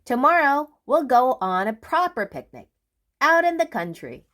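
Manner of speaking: fast, high eager plan; brisk Mister Busy. Acted. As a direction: rush it out.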